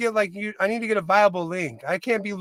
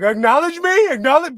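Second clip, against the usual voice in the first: higher, louder mock command of acknowledge me; mock Roman Reigns demand; 6 semitones higher, 5 dB louder than usual. Father imagines telling his crying son, mock wrestling-heel demand. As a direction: bark it like a wrestling heel, half-joking. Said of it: "I wanna be like".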